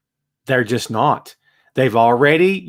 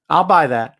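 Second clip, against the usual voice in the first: ordinary talk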